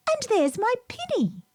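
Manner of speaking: put-on a child's eager cry; Lucy's voice. A narrator's reading. Little Lucy exclaiming over her lost handkerchief. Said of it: "cried Lucy".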